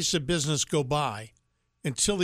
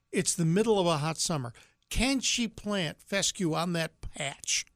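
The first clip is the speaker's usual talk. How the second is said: ordinary talk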